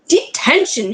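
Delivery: teacher catching a pupil at night, shouting. Acted. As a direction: bark the punishment at him. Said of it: "she shouted".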